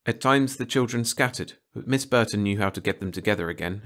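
usual speaking voice

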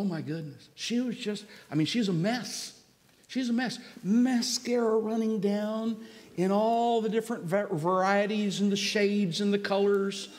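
usual speaking voice

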